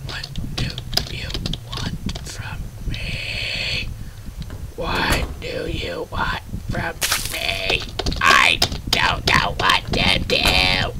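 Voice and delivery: slow, breathy whispered pleading; desperate whisper. Real, not acted. Player stuck in the game whispers pleas at the enemy, slow broken whisper. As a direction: whisper the plea as if about to cry.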